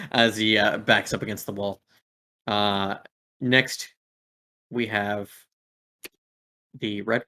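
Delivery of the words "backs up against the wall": ordinary delivery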